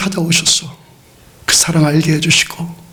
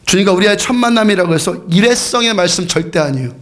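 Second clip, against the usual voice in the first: ordinary delivery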